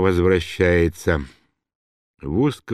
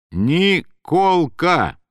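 higher, very drawn-out call; 6 semitones higher, much slower than usual. A narrator's reading. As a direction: call out syllable by syllable. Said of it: "повторил он".

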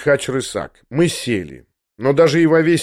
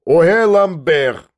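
high, slow, drawn-out taunting howl; loud mocking wail; louder howl; 4 semitones higher, 5 dB louder, much slower than usual. A narrator's reading. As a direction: stretch the name out in a loud jeer. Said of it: "завопил ещё сильнее".

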